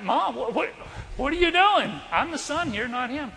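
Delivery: high, loud mock-outraged son; acting out an affronted kid; indignant protest. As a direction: protest to mom in disbelief, voice jumping up. Retold protest to his mother.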